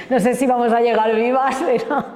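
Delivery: laughing through the words; real amused laugh breaking into speech. Real, not acted. Organiser joking to audience about making the opening, laughing.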